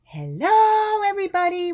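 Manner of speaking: huge, high hollered greeting; yelling hello. A narrator's reading. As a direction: holler it to the whole street. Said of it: "would yell Ruby".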